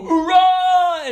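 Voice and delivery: high, loud Marine hoorah; shouted hoorah. Retold or imitated. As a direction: yell it like a recruit in formation. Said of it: "and then shout".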